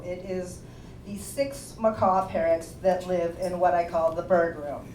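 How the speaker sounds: ordinary delivery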